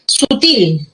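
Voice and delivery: SUTIL stressed on TIL; stress on last syllable. Shown as a demonstration. Teacher shows where the accent falls, stress on last syllable. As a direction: put the voice's force on the final syllable of SUTIL. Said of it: "La fuerza de voz está en".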